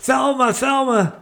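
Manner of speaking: high, mock yelled call across the yard. Man yelling for his wife from the car, high calling voice. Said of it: "he's yelling at his wife".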